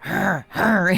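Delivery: short playful growling grunts, imitating the cat; mock cat growl. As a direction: growl like a cat guarding stolen food. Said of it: "he would start growling".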